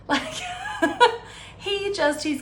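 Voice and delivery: laughing at the dry line. Real, not acted. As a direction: squeal with real laughter. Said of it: "the romance is overwhelming".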